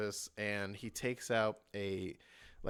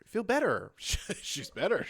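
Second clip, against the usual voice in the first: incredulous echo; 8 semitones higher, 5 dB louder than usual. Real, not acted.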